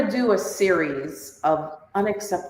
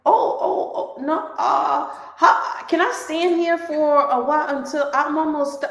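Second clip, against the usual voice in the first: flustered stalling; 6 semitones higher than usual. Retold or imitated.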